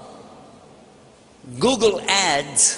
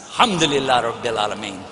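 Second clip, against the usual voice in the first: usual speaking voice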